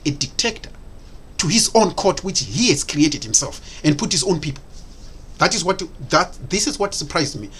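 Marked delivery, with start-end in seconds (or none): none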